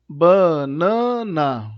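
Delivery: "banana" very slow and loud, ba, na, na; syllable by syllable. Shown as a demonstration. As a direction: stretch each of the three syllables of "banana". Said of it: "Três sílabas".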